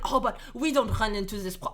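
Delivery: put-on imagined objector in a French accent. Teacher voices an objector to the point, French accent. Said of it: "I'm doing a French accent".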